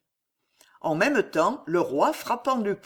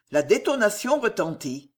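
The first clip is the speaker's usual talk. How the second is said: ordinary delivery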